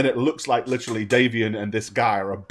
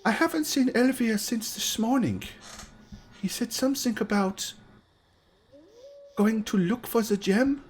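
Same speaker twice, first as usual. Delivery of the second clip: put-on much higher, soft girl's voice; young girl's voice; 12 semitones higher, 6 dB quieter than usual. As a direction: speak as the timid youngest daughter. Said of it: "kind of looks and says".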